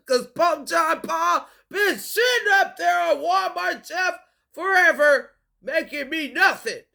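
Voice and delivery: high, loud hammed-up rant; comic ranting shout. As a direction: belt it out as a joking tirade. Comic rant about the pope.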